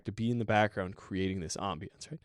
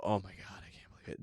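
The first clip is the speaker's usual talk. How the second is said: despairing whisper; 3 semitones lower, 9 dB quieter, faster than usual. Retold or imitated. Imitating a player after one mistake.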